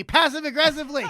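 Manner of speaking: ordinary delivery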